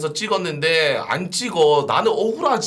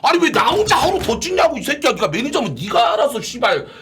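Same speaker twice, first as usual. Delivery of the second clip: angry yelling; 6 semitones higher than usual. Real, not acted. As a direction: rant hard at the manager. Yelling at manager about shoot.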